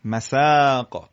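"masaaaq", ending on a stopped Q; long stretched vowel. Shown as a demonstration. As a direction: stretch the "aa" in مساق fully. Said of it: "قشنگ کشش بدید".

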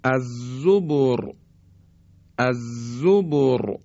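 الزبر with thick ra, slow; heavy ra. Shown as a demonstration. Teacher reciting next example slowly. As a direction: recite الزبر drawn out with emphatic ra. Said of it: "مثال بعدی".

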